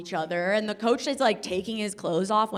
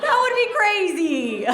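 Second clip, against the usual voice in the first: higher, louder, slower through laughter; laughing repeat; 11 semitones higher, 6 dB louder, slower than usual. Real, not acted. Repeating the punchline to the crowd, laughing and loud. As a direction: lean into the joke, laughing.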